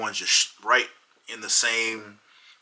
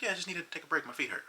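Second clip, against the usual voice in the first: higher and soft, unbothered; dreamy girlfriend; 8 semitones higher, 7 dB quieter, much faster than usual. Retold or imitated. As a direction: float it out lazily, no concern. Voicing girlfriend resting on bench.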